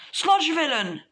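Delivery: high, loud challenge; calling out. Acted. Deku calling to the sludge villain, shouting. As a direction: shout to get the villain's attention.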